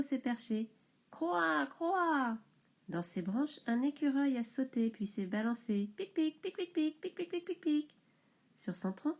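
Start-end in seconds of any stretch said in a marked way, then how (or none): 1.1-2.4 croassement aigu de corbeau; 1 croassement imité. A narrator's reading. 6.0-7.9 petits pics aigus et rapides. A narrator's reading. Bruit de l'écureuil imité.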